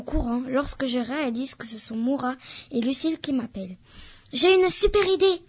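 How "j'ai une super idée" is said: higher and louder, eager and out of breath; breathless excitement. Acted. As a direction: burst out with the idea after running. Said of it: "me lance Lucille à bout de souffle". (excitée, haletante, plus aiguë et plus forte)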